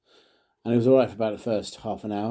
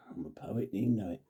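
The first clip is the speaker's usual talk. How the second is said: a quick unvoiced whisper of a little rhyme; whispered aside; 8 dB quieter than usual. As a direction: whisper it like a secret joke. Sing-song rhyme aside.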